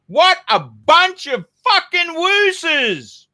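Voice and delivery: high, slow, drawn-out jeer; scornful. Real, not acted. Host jeering at Clive's friends, high and scornful. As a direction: sneer it at a bunch of cowards.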